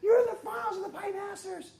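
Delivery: excited shout. Retold or imitated. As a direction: shout it while shaking him. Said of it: "shaking me, going".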